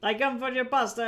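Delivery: a cartoon Italian, overdone on purpose; horrible Italian accent. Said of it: "and horrible Italian accent".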